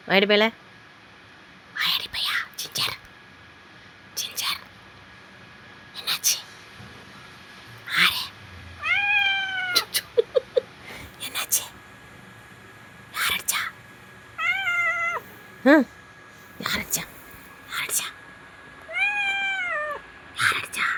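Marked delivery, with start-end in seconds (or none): 1.8-2.4 soft whisper to a pet; hushed, fully whispered pet talk. Real, not acted. 2.6-20.9 soft, coaxing whisper; hushed, voiceless pet talk. Real, not acted.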